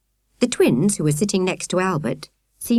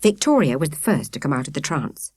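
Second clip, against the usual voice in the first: plain reading voice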